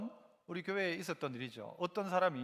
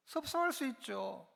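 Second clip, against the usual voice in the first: ordinary delivery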